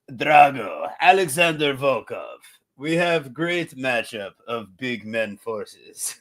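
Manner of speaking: hammed-up Russian accent, slowing down. Host introduces the heavyweight matchup in a mock Russian accent. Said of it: "you did your best Russian accent".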